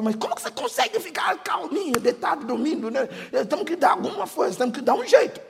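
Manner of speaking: high, quick anxious wife voice; worried wife. Retold or imitated. Husband imitating his wife fretting about money, high and quick. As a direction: fret like a panicking wife.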